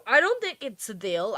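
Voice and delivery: normal talking voice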